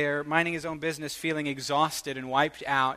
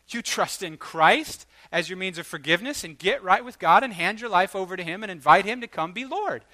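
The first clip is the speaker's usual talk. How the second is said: normal talking voice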